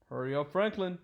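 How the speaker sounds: ordinary delivery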